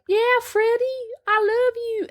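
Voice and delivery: very high put-on girl's voice; hammed-up girlish love declaration; mock lovestruck girl. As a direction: coo it way up high, playing the girl. Voices girl luring Freddy.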